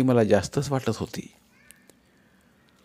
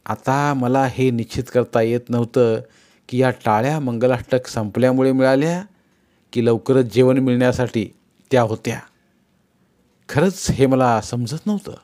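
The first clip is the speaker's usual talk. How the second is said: ordinary delivery, nothing marked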